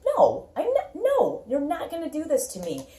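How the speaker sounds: fiery refusal. Retold or imitated. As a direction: push back with fiery spirit. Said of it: "fiery spirit where you're like".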